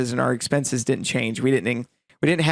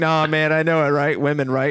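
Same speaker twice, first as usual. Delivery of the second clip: bro salesman; 3 semitones higher, 3 dB louder than usual. Retold or imitated. Salesman voiced in retold story.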